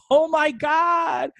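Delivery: high, drawn-out celebratory cry; overexcited. Retold or imitated. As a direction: gush hugely, stretching every word. Reenacts overjoyed greeting to host.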